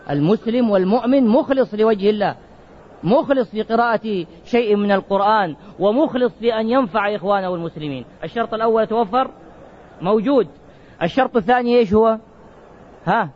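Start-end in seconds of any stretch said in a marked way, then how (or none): none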